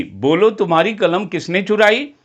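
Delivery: normal talking voice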